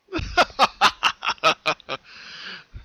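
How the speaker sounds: loud, high evil laughter; villainous laugh. Acted. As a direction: laugh at her horror. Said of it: "The colt laughed".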